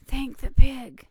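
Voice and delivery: slow, loud quoted pig line; slow booming movie quote. Retold or imitated. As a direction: boom it out like the film pig. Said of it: "You sound like".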